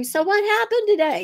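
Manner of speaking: mild imitation of calling through the house; calling across house. Husband asks from another room, lightly calling out. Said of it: "you yell at each other across the house".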